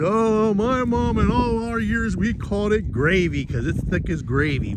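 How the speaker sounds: hammed-up cousin defending gravy; mock East Coast cousin. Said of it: "back east and says".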